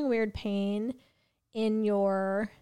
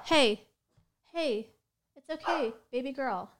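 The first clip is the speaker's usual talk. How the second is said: calm the frightened dog gently; cooing to a scared pet; 3 semitones higher than usual. Real, not acted. Soothing barking dog at home.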